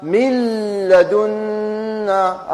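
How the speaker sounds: من لدنا with the nun merged into the lam, very slow and drawn out; slow tajweed demo. Shown as a demonstration. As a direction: stretch لدنا slowly like a Quran teacher. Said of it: "أقرأها؟". (قراءة مجودة بطيئة لمن لدنا)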